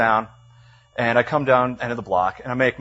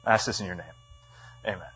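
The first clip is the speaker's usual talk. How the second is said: lower, subdued praying voice; prayer; 4 semitones lower than usual. Real, not acted. Leading the room before the text, in low prayer. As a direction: pray aloud in a low, humble tone.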